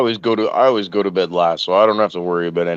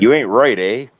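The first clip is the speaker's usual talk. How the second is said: lightly put-on Canadian accent with a questioning "eh"; mock Canadian lilt. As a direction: do a joking Canadian, tag question at the end. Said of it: "In a Canadian accent".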